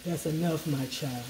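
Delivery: deep, hushed solemn matriarch; Oprah. Retold or imitated. Imitating Oprah playing a solemn matriarch, low and hushed. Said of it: "let's say like Oprah".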